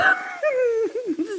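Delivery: high wailing laugh, almost crying; helpless high-pitched cackle; laughing fit. Real, not acted. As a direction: burst into a high, tearful-sounding laugh. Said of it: "je rigole".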